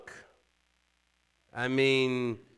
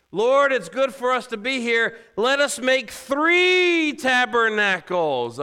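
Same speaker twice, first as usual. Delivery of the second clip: high, loud acted-out eagerness, stretching the last phrase; caught-up Peter; 12 semitones higher, 9 dB louder than usual. Preacher acting out Peter's gaffe, loud and carried away. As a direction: proclaim it as an overexcited disciple. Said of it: "He was caught up in the moment".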